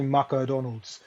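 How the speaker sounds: put-on Japanese accent. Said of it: "Every syllable is announced".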